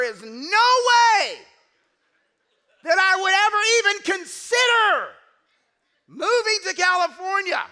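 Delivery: very loud, high, slow flat refusal; hammed-up emphatic refusal shout. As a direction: bellow that it will never happen. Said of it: "I was very emphatic about it".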